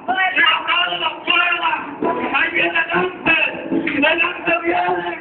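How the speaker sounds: high, stretched-out exclamations; impassioned, repetitive preacher's cries; fervent preaching. Real, not acted. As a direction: chant short phrases with revival fervour. Preaches at full force.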